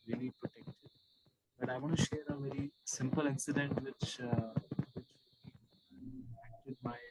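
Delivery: very faint, soft voice; speaking far too softly to be heard; barely audible. Real, not acted. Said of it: "Your voice is very faint".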